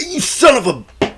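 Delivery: high-pitched imitation of an angry man; mock angry outburst. Movie fan imitating Bill about to whoop his rival, mock angry. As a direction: act like you're squaring up to fight. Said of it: "about to whoop him".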